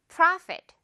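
bright clear Profit to copy; high model Profit. Shown as a demonstration. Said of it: "듣고 따라해주세요".

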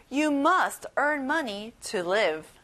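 very slow, carefully spaced sentence; slow model sentence. Shown as a demonstration. Teacher modelling a refund request slowly. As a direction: say it slowly to be memorised. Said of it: "같이 말해볼게요".

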